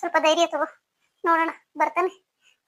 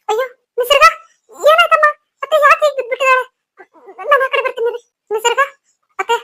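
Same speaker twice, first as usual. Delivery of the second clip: high, loud alarmed questions; frightened panic; 8 semitones higher, 7 dB louder than usual. Acted. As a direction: panic over the fainted girl. Rushing to the fainted girl. (ಭಯದಿಂದ ತತ್ತರಿಸಿದ ಧ್ವನಿ)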